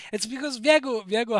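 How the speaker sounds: talking loud through a burst of laughter; laughing. Real, not acted. Streamer to chat about Viego, laughing loudly. Laughing at chat's accent jokes.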